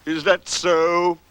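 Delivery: slow, drawn-out donkey voice; ponderous, slow question; Eeyore. Acted. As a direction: say it slowly, unimpressed. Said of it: "said Eeyore".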